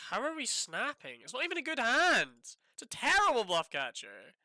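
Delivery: high, loud, incredulous rant; loud, exasperated protest; exasperated outburst. Real, not acted. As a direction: raise the pitch and volume in real disbelief. Ranting at opponent's snap call.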